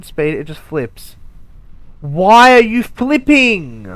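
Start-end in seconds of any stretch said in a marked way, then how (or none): 2.0-3.9 loud, slow, high shout at the rocket; stretched, pitched-up angry question; exasperated yell. Real, not acted. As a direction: yell at the machine like it's misbehaving. Yelling at the rocket.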